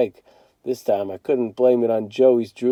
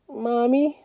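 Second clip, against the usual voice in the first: high, soft and frightened; a small boy calling out in fear; scared little boy; 11 semitones higher, 5 dB quieter than usual. A narrator's reading. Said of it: "sounding real scared".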